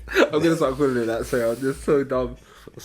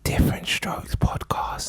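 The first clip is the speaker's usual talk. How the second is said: slow, hushed mic whisper; ASMR whisper; much slower than usual. Pretend. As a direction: whisper the show name slowly. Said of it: "sounds like A S M R".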